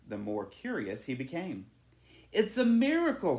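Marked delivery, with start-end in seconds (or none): 2.3-3.4 higher and louder, full of wonder; a villager bursting with amazement, higher and louder; excited exclaim. A narrator's reading. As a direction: announce the miracle with awe. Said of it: "said one man".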